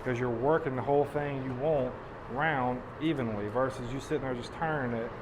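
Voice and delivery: normal talking voice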